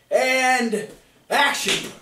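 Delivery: high, drawn-out "AND", then punched "action"; Hollywood director. Retold or imitated. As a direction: call it like a showy film director. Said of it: "In Hollywood, they say".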